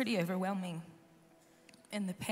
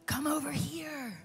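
a slow, higher, luring call; voice of darkness; 5 semitones higher, slower than usual. Retold or imitated. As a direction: lure them over, drawn out slowly. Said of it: "the darkness is screaming".

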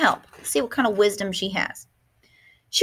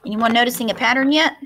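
ordinary delivery, nothing marked

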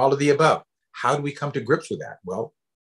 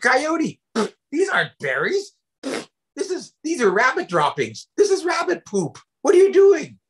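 very high, indignant squawk; acted revolted protest, pitch shooting way up; disgusted outrage; 13 semitones higher than usual. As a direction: accuse the trickster in high, horrified disbelief. Badger discovers he ate rabbit poop.

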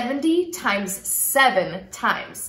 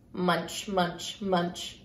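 low munching; 8 semitones lower, 8 dB quieter, much slower than usual. Acted. Bear munching at the end.

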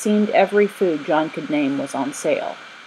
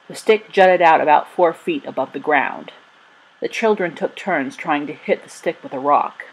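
ordinary delivery, nothing marked

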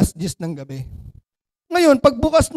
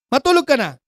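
angry father; 3 semitones higher, 5 dB louder than usual. Acted. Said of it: "Galit yung tatay".

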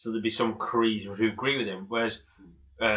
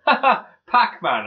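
high, loud, drawn-out glee; re-enacted delighted reaction; amused outburst; 9 semitones higher, 7 dB louder, slower than usual. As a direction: crow it slowly with delight. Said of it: "And we just went".

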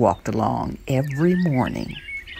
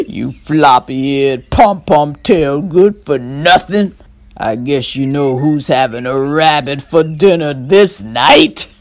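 slow, louder mocking insults; gloating fox; 5 dB louder than usual. Burr Fox taunting the stuck rabbit, slow and loud. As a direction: relish each insult slowly.

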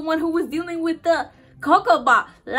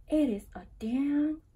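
hushed, much softer whisper; awed whisper; 9 dB quieter than usual. Real, not acted. Fan naming the love shot, awed whisper. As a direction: whisper it in disbelief. Echoing the song title in awe.

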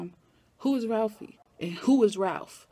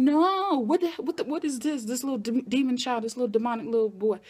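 hammed-up alarm, starting high and settling down; shocked disbelief; 4 semitones higher than usual. Acted shocked reaction to charging boy.

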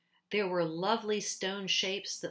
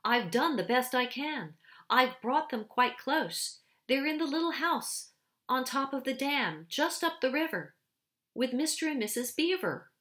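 higher, anxious excuses to the queen; anxious pleading; 6 semitones higher, 3 dB louder than usual. A narrator's reading. Boy anxiously pleads with the witch queen. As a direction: plead for approval, voice lifted and strained. Edmund anxiously justifies himself.

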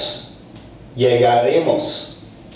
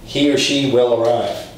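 ordinary delivery

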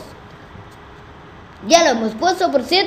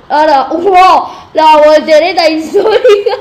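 excited; 8 dB louder than usual. Real, not acted. A wild flip in the game.

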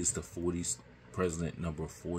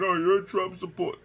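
much higher, fast, whining mockery of complainers; mocking whiny quote; 11 semitones higher, 5 dB louder, faster than usual. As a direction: mimic a whiny accuser, pitched way up. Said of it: "people are gonna whine and complain".

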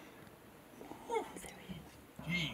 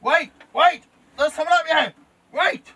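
loud high calling out; shouting; 14 semitones higher, 14 dB louder than usual. Real, not acted. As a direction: shout at someone in the dark. Calling out to an intruder.

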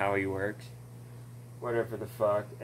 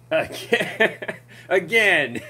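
laughing again; 6 semitones higher, 6 dB louder, slower than usual. Retold or imitated. Mocking the endless fighting.

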